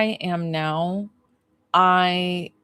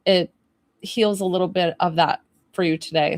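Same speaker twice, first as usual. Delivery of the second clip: usual speaking voice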